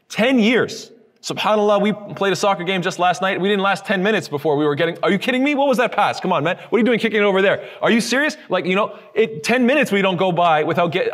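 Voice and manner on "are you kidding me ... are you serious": higher-pitched, exasperated complaints; frustrated scoffing. Retold or imitated. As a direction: imitate an annoyed teammate griping mid-game. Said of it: "expressing some sort of scoffing".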